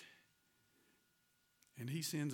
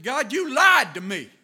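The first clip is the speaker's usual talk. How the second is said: furious accusation; 10 semitones higher, 17 dB louder than usual. Retold or imitated. Angry preacher accusing God of lying, shouting. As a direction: hurl each accusation at God. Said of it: "He said".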